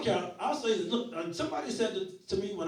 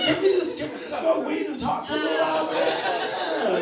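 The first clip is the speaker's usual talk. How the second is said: excited plea; 9 semitones higher, 6 dB louder than usual. Acted. Pleading to smoke with crowd.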